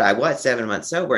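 ordinary talk, nothing marked

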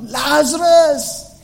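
high drawn-out call; long slow calling shout; crying out Lazarus. Retold or imitated. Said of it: "he cried out".

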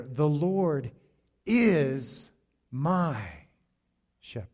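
"is" and "my" drawn out, each set apart; slow, weighty stress on "is" and "my". Shown as a demonstration. Pastor reading the psalm's first words, emphatic "is my". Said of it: "I want you to emphasize that".

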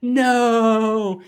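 host acting out Little John's scream; mock no shout. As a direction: cry no in a panic. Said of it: "you just hear".

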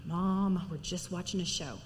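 low, subdued imitation of a kid; protesting kid. Voices kids protesting to their mother.

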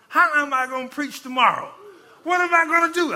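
rising, loud desperate questions; acted-out helpless dismay; retold panic. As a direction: cry out at a loss, pitch climbing high. Retold panic before having to preach.

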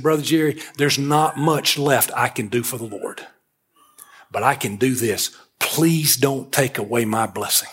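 low, slowing plea, mildly choked up in retelling; near tears. Retold or imitated. Old man begging the young preacher to accept help, near tears. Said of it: "welled up like he was about to cry".

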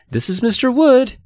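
high-pitched little presenter; girl presenting dummy. A narrator's reading. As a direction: introduce it in a high girlish character voice. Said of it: "she told the Millers".

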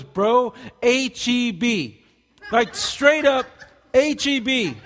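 loud, high, drawn-out hype; mock-reverent excitement, punched hard; awestruck bro raving. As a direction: imitate a blown-away bro, stretching each letter. Retold newcomer raving about grocery store.

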